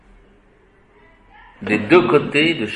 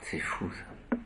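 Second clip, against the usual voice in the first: awed whisper; 7 semitones lower, 20 dB quieter, slower than usual. Real, not acted. Moved by aimer coming from maman.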